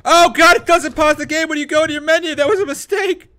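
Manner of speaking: high, loud and frantic; frantic panic. Real, not acted. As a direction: babble in full panic as you get hit. Game doesn't pause, he's attacked.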